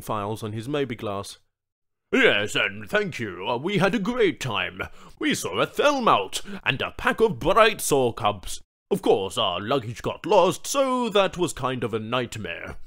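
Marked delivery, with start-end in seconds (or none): 2.1-12.8 a corporate man making small talk; chatty businessman. Acted. The suit recounts his safari.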